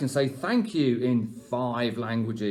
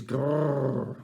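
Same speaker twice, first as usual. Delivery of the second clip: growly name. Acted. Naming the mean neighbour.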